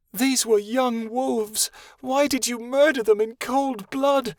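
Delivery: high, slow, tearful reproach; sobbing. Acted. Vera accuses Winter of murdering the wolves, sobbing. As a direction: let the sob slow every word. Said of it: "she sobbed".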